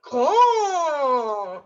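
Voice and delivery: loud, long drawn-out word; big swelling, stretched vowel; acting out big. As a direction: make the word sound enormous.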